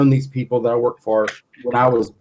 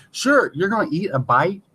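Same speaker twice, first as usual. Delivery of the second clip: usual speaking voice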